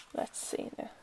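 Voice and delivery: soft whispered aside while studying cards; whisper to self. Real, not acted. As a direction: breathe it out to yourself. Muttering to herself over cards.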